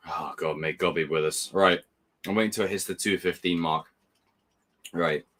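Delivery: usual speaking voice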